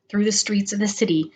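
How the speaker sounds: plain reading voice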